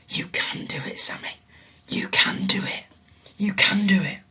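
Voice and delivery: whispered encouragement; faint whisper. Acted. Mysterious faint voice whispering to Sammy, encouraging. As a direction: breathe it like a distant voice. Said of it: "that faint voice".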